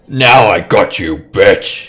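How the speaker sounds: loud triumphant battle yell; forceful in-character yell. Acted. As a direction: shout it at a foe as you attack. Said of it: "he yells out".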